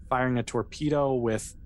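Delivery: ordinary talk, nothing marked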